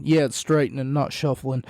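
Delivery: ordinary talk, nothing marked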